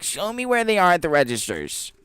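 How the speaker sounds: high, loud mocking demand; demanding customer. Imitating the customer demanding to see signs, loud and high. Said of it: "she's like".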